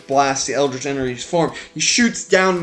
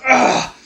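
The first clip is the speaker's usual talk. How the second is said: sharp high grunt of pain as he falls; villain knocked backwards, grunting out loud; 10 semitones higher, 4 dB louder than usual. Acted. Villain knocked into the hole, pained high grunt. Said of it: "he goes".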